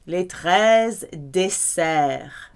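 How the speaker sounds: Les treize desserts, slow and deliberate; slow model phrase. Shown as a demonstration. Teacher slows right down to model the phrase. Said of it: "slow model phrase".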